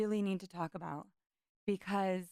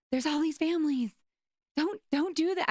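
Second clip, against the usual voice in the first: high, loud, urgent inner plea; urgent pleading; 9 semitones higher, 6 dB louder than usual. Retold or imitated. To her friend, inwardly begging her to stop, high and loud. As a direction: burst out begging her not to. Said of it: "I wanted to just jump out of my skin".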